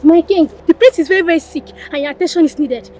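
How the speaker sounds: high, rapid, urgent delivery; breakneck, alarmed messenger; panicked report. Acted. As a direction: blurt out the alarming news.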